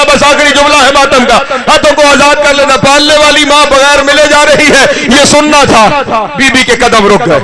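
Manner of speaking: loud mourning sermon, rising towards a high pitch; impassioned lament preaching. Acted. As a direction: build a grieving majlis narration to a fevered cry. Preaches tragic lament at climax. (پُرجوش نوحہ خوانی جیسا خطاب)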